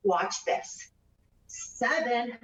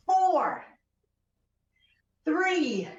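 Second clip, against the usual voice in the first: strained count; 7 semitones higher than usual. Real, not acted. Counting reps while exercising.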